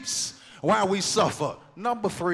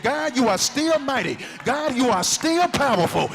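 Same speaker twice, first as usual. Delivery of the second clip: loud, high, driving praise; preaching at full force; 7 semitones higher, 9 dB louder than usual. Acted. Preacher leading the church in loud praise, full force. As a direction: pour it out, building to a shouted Jesus.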